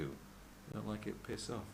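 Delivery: ordinary delivery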